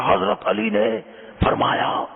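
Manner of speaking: high-pitched mock preacher's cry, breaking off before the last word; hammed-up pulpit yell; imitated loud preacher. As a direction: mimic a cleric straining to bellow. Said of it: "بہت زور سے وہ حضرت علی کا ارشاد سنا رہے تھے".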